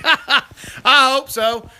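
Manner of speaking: laughing at a viewer's joke question. Real, not acted. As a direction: chuckle out the answer.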